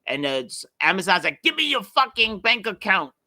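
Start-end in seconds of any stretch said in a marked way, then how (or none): 1.4-3.1 mock aggressive demand, pitched up; demanding scam caller. Fake Amazon caller demanding bank details, mock aggressive. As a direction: put on a pushy caller making a crude demand.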